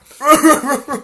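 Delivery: loud, high-pitched bawling; hammed-up hysterical sobs; crying. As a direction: wail like a big baby. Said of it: "crying all over the place".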